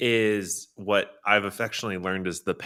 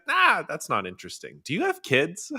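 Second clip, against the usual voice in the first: breezy dismissal; 6 semitones higher than usual. Retold or imitated. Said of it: "He's like".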